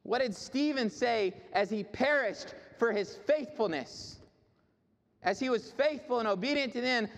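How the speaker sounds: high, emphatic sermon delivery; fervent preaching. Real, not acted. Preacher lifting up Stephen's faith to the congregation, fervent preaching. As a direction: drive it home to the congregation.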